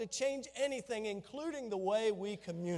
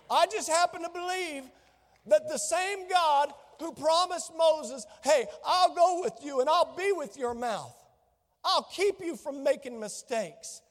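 building sermon climax; 4 semitones higher, 3 dB louder than usual. Acted. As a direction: preach it with rising conviction.

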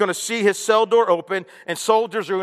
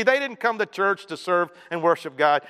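usual speaking voice

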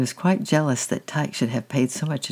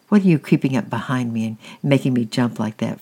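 ordinary delivery

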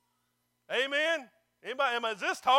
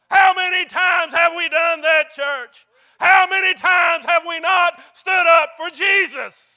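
loud, raised-pitch pulpit challenge; full-force preaching; 5 semitones higher, 14 dB louder than usual. Real, not acted. Preacher challenging the church about standing up for Jesus, shouted and impassioned. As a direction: drive each phrase out to the whole church.

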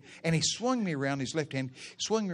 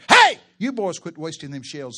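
mom's holler; 10 dB louder than usual. Retold or imitated. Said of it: "and holler".

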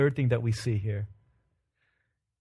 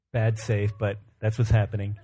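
ordinary delivery

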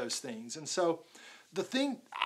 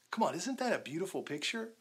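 ordinary delivery

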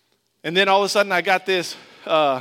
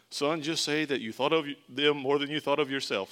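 dad's advice; 3 dB quieter than usual. Retold or imitated. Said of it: "My dad gave me".

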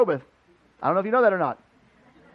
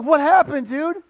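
eager question; 9 semitones higher, 7 dB louder, slower than usual. Retold or imitated.

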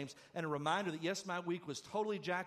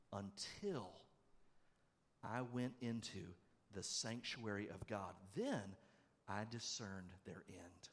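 softer, slow, solemn reading of the verse; quiet scripture reading; 7 semitones lower, 7 dB quieter, slower than usual. Shown as a demonstration. Preacher reading the Psalm to the congregation, quiet and slow. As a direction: read it hushed, letting each word land. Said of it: "listen to this in verse seventeen".

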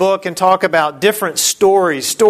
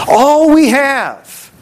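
incredulous; 7 semitones higher, much slower than usual. Acted. Disciples list scant food.

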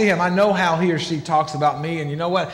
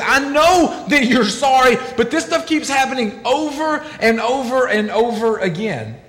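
exasperated wife; 6 semitones higher than usual. Retold or imitated. Wife shouting back at her apologizing husband, fed up. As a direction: let it burst out, fed up.

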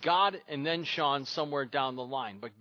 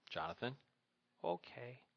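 resigned dad; 3 semitones lower, 12 dB quieter than usual. Retold or imitated. Father giving in to son.

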